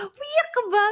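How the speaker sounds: high surprised question; surprised friend. Retold or imitated. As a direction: ask it way up high. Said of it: "sabi niya".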